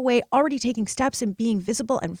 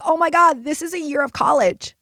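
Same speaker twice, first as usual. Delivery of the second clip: loud, gasping oh my God at the price; shocked reaction; 6 semitones higher, 7 dB louder than usual. Retold or imitated. Telling listeners her shock at the coach's price, loud and stunned. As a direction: blurt it out, stunned by the cost.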